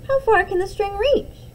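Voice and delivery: put-on high, excited child's question; eager child. A twin eagerly asks Mom how far it reaches. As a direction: ask with wide-eyed wonder.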